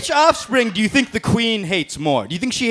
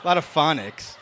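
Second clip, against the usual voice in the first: ordinary talk, nothing marked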